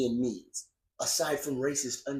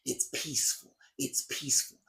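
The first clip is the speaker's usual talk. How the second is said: mocking timid organizers; 4 semitones lower, 4 dB quieter than usual. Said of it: "they harp in their advertisement about".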